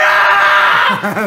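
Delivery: a high, loud laughing yelp; startled laughing shout. Real, not acted. Player startled by the ship, high laughing yelp. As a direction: yelp in shock, then laugh.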